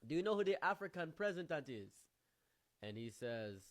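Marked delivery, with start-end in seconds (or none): 0.0-1.9 put-on raised, loud African-accented question; put-on African accent, calling across; imitating African friend. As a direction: quiz them loudly in an African accent. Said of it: "Then he said".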